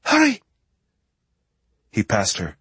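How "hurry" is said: urgent French woman. Acted. Justine urging injured man.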